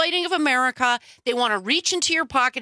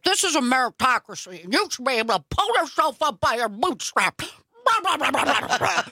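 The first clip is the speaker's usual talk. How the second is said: blustering parody of a bootstrap preacher; mock conservative uncle. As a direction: send up the slogan, dissolving into blah-blah. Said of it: "That was a great impression. ... You sound like my uncle".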